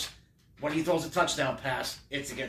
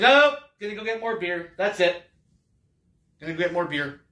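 high, loud fed-up nopes, storming off for beer; exasperated yelling; 5 semitones higher, 7 dB louder than usual. Acted. Fan fleeing the halftime show, high loud exasperated yelling. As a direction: bolt from the room shouting nope.

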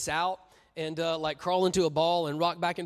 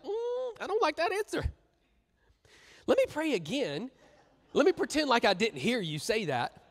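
high, whiny put-on voice; the believer ignoring God, high and whiny; mock dodging believer; 7 semitones higher than usual. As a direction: play the believer pretending not to hear. Mock praying believer dodging answer.

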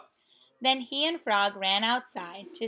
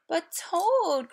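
higher, louder plea; crying out; 7 semitones higher, 3 dB louder than usual. Acted. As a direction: cry out begging a friend. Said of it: "cried Frog".

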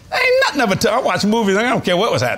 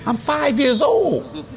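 laughing; 8 semitones higher, much slower than usual. Real, not acted. Laughs at grandfather's rebuke.

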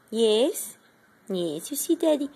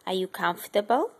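normal talking voice

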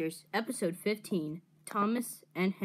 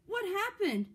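high, startled question; cried out; 11 semitones higher than usual. A narrator's reading. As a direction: cry it out high, taken aback. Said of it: "cried Thomas".

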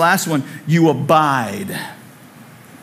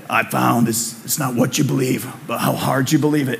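put-on low gravelly old-man voice; gravelly Harrison Ford; 4 semitones lower, 3 dB quieter than usual. Pastor mimicking Harrison Ford's movie line, low and gravelly. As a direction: growl it like an aging Harrison Ford. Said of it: "he said in that gravelly voice".

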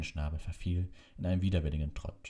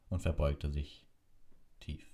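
plain reading voice

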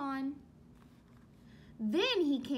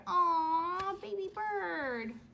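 cooing aw; 4 semitones higher, 3 dB louder, much slower than usual. Real, not acted. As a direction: coo like at a cute picture. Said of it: "teacher coos at picture".

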